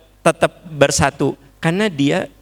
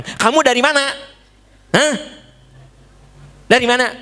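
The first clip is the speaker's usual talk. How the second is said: angry interrogation; 11 semitones higher, faster than usual. Acted. A mother grills her child who came home late, angrily. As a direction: snap at the child where have you been.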